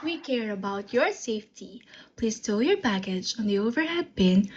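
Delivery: low, soft flight attendant read; cabin safety announcement. Pretend. Flight attendant briefing passengers on safety, low soft announcement. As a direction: recite the safety rules low and smooth.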